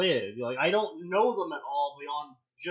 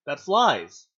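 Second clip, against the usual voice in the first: normal talking voice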